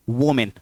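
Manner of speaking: वोमेन said "wo-min" with an O; wrong pronunciation. Shown as a demonstration. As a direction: give the first vowel of वोमेन an O sound, wo-min. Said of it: "ना कि".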